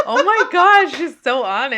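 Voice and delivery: high, loud laughing exclamation; helpless amused outburst; real cracking up. Real, not acted. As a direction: gasp and blurt it through laughter. Listener cracking up at story.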